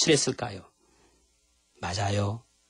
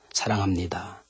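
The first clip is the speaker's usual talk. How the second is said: soft confession; 7 semitones lower, 6 dB quieter than usual. Shown as a demonstration. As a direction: lower and softer, tender. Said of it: "주님 사랑합니다"라고 고백 좀".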